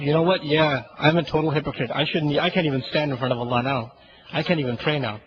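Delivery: low, resigned inner voice; mildly dejected self-talk. Acted. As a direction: sink into a subdued admission of defeat. Sinner's dejected self-talk after accusation.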